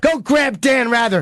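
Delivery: high, drawn-out holler; mock yelling. Host demanding they fetch the anchor, mock yelling.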